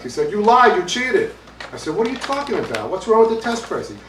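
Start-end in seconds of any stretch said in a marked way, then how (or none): 0.3-1.3 loud, high, slow accusation; acted outrage, drawn out; yelling accusation. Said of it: "he calls me up and he's yelling". 1.9-4.0 high-pitched acted bewilderment; mock-baffled Brooklyn comeback; bewildered. Bewildered reply to yelling accuser.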